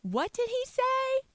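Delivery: high, slow, sassy disbelief; drawn-out mock outrage. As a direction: gasp out the question scandalised. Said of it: "Or you could say".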